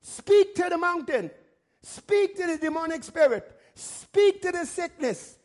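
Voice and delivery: insistent pulpit urging; emphatic exhortation. Real, not acted. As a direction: drive each command home. Preacher urging forceful spoken commands.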